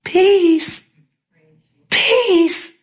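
high, drawn-out peace, peace, peace; high, awed peace three times over; dreamy peace. Retold or imitated. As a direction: float each peace up high like a man in bliss. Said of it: "this is exactly how he said it".

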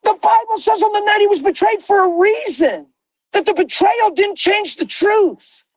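high, driving preacher's cry with shouted peaks; preaching at full force. Acted. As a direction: lift the room like a pulpit.

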